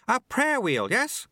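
very high, chirpy character voice; eager apprentice. A narrator's reading.

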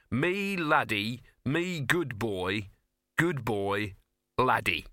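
slow mock-adoring dog talk. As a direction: draw out each phrase, mimicking a simple good dog. Gaspode mockingly translates Laddie's barks.

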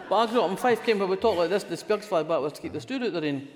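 thick Fife dialect, loud and quick; broad Fife Scots, full strength; broad Fife Scots accent. Shown as a demonstration. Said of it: "what my partner calls my Radio Four Scottish accent".

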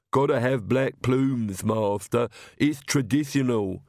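slow, thick-tongued servant; Igor. A narrator's reading. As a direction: plod through it as a stubborn minion. Igor answers his master.

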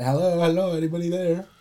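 hopeful gamer on mic. Pretend. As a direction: call out to random players online. Acting out game mic chat.

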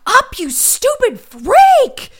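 high, loud, drawn-out acted scream; furious scream. Lois screams back at Jennifer's farewell, enraged and loud. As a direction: stretch each word into a raging scream. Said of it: "Lois just screams at her".

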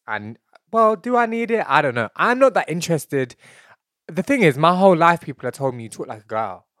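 ordinary talk, nothing marked

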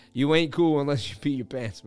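usual speaking voice

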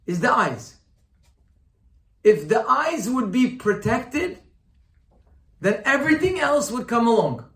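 loud, high-pitched sermon delivery; impassioned preaching. Real, not acted. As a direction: drive the teaching home forcefully. Preaching at full force.

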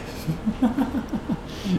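high, giggly burst of laughter; real laugh. Real, not acted. Podcaster cracking up over the dancing man, high laugh. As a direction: crack up helplessly.

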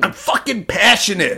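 high-pitched imitation of a drunk; mock drunk speech. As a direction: play the passionate drunk speechmaker. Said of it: "he kinda got drunk and did a speech".

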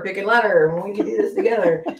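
ordinary delivery

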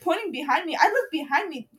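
usual speaking voice

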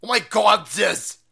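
usual speaking voice

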